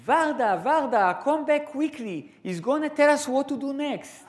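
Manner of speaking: high, loud call to someone outside; excited man calling from the doorway; shouting across the room. Retold or imitated. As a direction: yell for Varda to hurry back. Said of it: "he leaned outside and he shouted".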